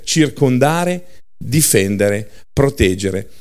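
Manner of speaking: circondare drawn out slowly; slow emphasis. Shown as a demonstration. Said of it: "slow enumeration".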